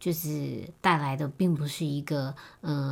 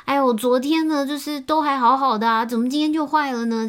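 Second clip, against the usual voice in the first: higher, louder, fretful whine; whiny girlfriend; 8 semitones higher, 7 dB louder than usual. Retold or imitated. Girlfriend whining to boyfriend about her broken car, high and loud. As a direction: pout and complain that the car broke. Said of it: "然后那女生又自己说".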